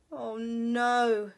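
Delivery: loud, despairing groan; weary bear. Acted. Mister Bear's weary complaint.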